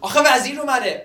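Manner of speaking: loud, high, pleading insistence; shouting. Acted. Young man justifying his false alarm to her, still shouting. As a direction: keep yelling as an excuse.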